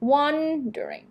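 "Wondering" with a high, long WON, the rest reduced; first-syllable stress. Shown as a demonstration. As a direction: hit WON in "Wondering", then let it fall. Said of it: "stress ที่พยางค์แรก แล้วกร่อนพยางค์ถัดๆมา".

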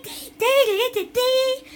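loud, high rhythmic syllables; yelled "dee" chant. Pretend. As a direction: yelp the chant out harder. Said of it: "I'm just yelling".

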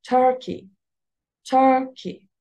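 TUR-key, high on "Tur", "key" snatched short; Turkey with a quick, light ending; first-syllable stress. Shown as a demonstration. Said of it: "تر هي اللي عليها الستريس".

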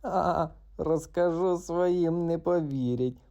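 high, slow sneering frog voice; mocking frog. Frog jeers at the cat, high and mocking. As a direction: jeer at the cat from hiding. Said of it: "продовжував насміхатись".

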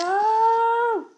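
shocked, then breathless whisper; scared horror game streamer. Real, not acted. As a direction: shout dropping to a whisper. Jumpscare shock.

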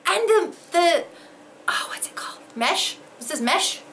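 ordinary delivery

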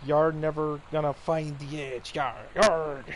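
lightly voiced pirate growl, slowed; a hint of a hammed-up pirate captain; pirate villain. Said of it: "he yelled to Captain Allegra".

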